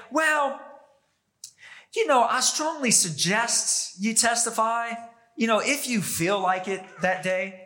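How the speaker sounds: a limp, half-hearted suggestion; mock casual suggestion. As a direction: shrug it off like it hardly matters. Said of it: "Jesus didn't say".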